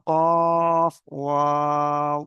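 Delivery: কফ held long and slow, then wow stretched; slow chanted spelling of কফ; drawn-out recitation. Shown as a demonstration. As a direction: intone the letter names slowly for the class. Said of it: "এই দেখেন".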